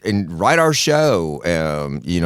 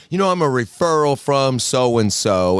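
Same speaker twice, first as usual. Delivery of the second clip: lightly voiced patient, slightly higher pitch; patient introducing herself; 4 semitones higher than usual. Lady walks into the psychiatrist's office, lightly voiced patient. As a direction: play the new patient, pitched up a little.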